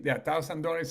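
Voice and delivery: ordinary talk, nothing marked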